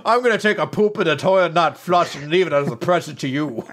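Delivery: fast, high, loud put-on voice; mock threat. [name] threatening revenge, fast high loud put-on voice. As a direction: rattle off a petty threat gleefully.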